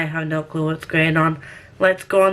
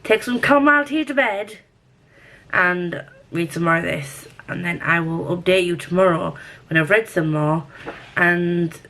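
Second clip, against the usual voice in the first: ordinary talk, nothing marked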